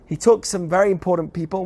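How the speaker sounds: ordinary delivery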